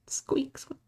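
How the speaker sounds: little high squeaked words; rat squeaks. Pretend. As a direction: squeak lightly like a pretend rat. Demonstrates random rat sounds for haunting.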